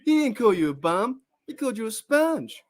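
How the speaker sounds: high, bouncy cartoon quote; lightly voiced Simpsons character. Quoting Simpsons, high put-on voice.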